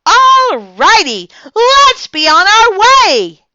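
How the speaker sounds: very high, loud, slow excited shout; singsong excited little-girl shout. A narrator's reading. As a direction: shout it, thrilled to leave. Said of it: "I shouted, very excited".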